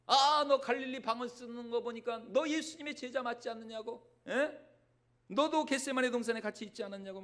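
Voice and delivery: put-on a servant girl pressing Peter, loud and accusing. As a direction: corner him with each question. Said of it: "계집종의 그 말 한마디".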